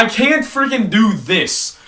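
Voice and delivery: normal talking voice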